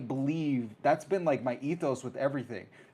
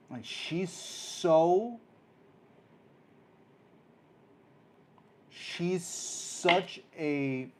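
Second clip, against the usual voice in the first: awed slow emphasis; 5 semitones higher, much slower than usual. Real, not acted. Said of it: "emphatic amazement".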